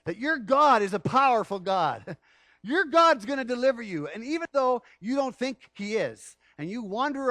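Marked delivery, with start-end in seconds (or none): 0.1-4.1 loud, high proclaiming; preaching at full force. Acted. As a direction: thunder out the promise. Preaching the good news loudly.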